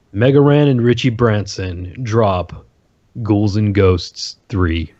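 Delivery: ordinary talk, nothing marked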